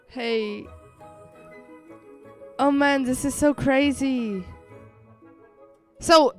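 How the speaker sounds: ordinary talk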